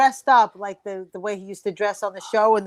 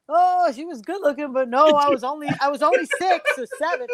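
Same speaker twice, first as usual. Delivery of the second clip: Butch answering fans; 3 semitones higher than usual. Retold or imitated. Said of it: "He's like".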